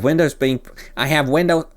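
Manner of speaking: half-put-on comic voice; Tim Conway voice. Reading client's text before switching accents, lightly voiced. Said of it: "making you sound like Tim Conway".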